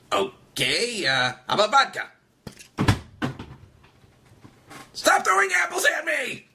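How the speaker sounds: high, pushy puppet begging for a drink, then shouting; a pestered puppet yelling at the crowd; whiny puppet host. Acted. Puppet host to the audience, whiny then shouting.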